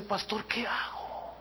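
whispered, slow worried question; whispered plea. Retold or imitated. Frightened soldier asking the pastor for help, whispered. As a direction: breathe it out like a scared secret.

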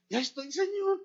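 high, pleading voice searching for words; mock desperate prayer. Sister praying for a sermon, pleading high voice. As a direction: act out begging the Lord for a sermon. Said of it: "Y se mete a orar".

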